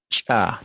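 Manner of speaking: "cha" with CH popped sharply before the vowel; explosive CH. Shown as a demonstration. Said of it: "y la explosiva, explotando".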